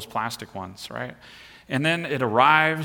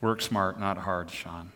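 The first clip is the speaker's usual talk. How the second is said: much softer, fading to a near murmur; wry aside to himself, dropping away; quiet self-rebuke; 7 semitones lower, 6 dB quieter, slower than usual. Real, not acted. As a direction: scold yourself under your breath.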